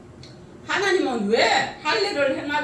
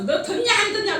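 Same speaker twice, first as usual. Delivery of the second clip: a higher, half-laughing mock of a scolding mother-in-law; 7 semitones higher, 3 dB louder than usual. Mother-in-law nags daughter-in-law on phone, mock scolding. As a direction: play the nagging mother-in-law, partly laughing.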